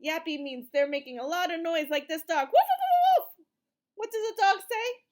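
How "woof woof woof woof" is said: loud, high barking woofs; dog bark. Shown as a demonstration. Teacher barking for the kids, loud and high. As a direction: bark like a big playful dog.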